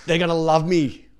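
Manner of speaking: high, loud, naively delighted retelling; innocent confidence. Retold or imitated. Comic imagining everyone will love him, loud naive delight. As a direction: gush with total misplaced confidence. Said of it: "I was so pure and innocent".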